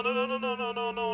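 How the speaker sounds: ordinary talk, nothing marked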